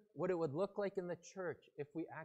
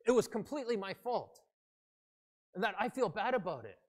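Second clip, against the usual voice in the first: higher, loud angry confession of blame; angry apology; 5 semitones higher, 8 dB louder than usual. Acted. Driver who rear-ended a car to the other driver, angrily. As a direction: confess it as if picking a fight. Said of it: "he says it like he's angry".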